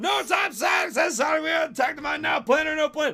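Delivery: high, shouted urgent commands; urgent hero orders. Acted. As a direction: rush everyone out the door. Sonic urgent orders, high throughout.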